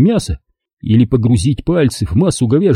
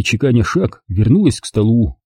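usual speaking voice

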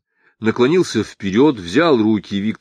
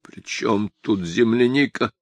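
ordinary delivery, nothing marked

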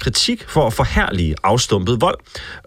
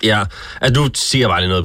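ordinary talk

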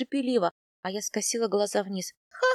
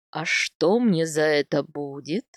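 lower, slow sly voice; sly smile; 6 semitones lower, 4 dB louder, slower than usual. A narrator's reading. Husband slyly bargaining with his wife, lower and slow. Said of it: "хитро улыбнулся Степняк".

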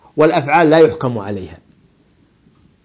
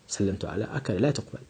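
"سلمت على أكل" said much softer and lower, dismissed; quiet rejection; 6 semitones lower, 15 dB quieter than usual. Shown as a demonstration. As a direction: mutter the wrong phrase and reject it.

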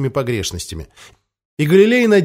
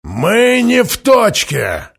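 gloomy shout; 7 semitones higher, 4 dB louder, much slower than usual. Acted. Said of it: "хмуро сообщил".

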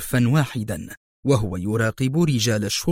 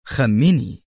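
plain reading voice

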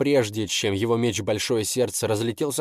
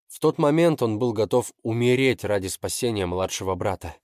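usual speaking voice

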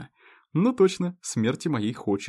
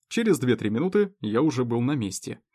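ordinary delivery

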